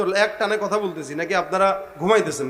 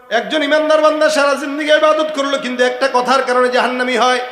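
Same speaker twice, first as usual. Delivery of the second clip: raised, loud sermon moral; full-force preaching; 4 semitones higher, 5 dB louder than usual. Real, not acted. Preacher warns the congregation about one word, full-force preaching. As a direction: drive the warning home.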